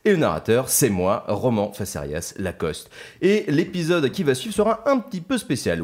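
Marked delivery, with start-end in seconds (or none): none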